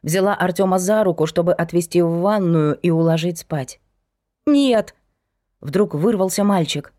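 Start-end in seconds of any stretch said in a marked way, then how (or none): 4.5-4.9 high, loud sudden cry; shouted no. A narrator's reading. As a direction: burst out in protest. Boy suddenly breaks away.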